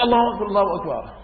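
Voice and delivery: rushed, running Allahu akbar; hurried takbir. Retold or imitated. Latecomer rushing to join the group, gabbling fast. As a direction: gabble it while hurrying to catch the prayer. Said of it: "بتلاقي دغري".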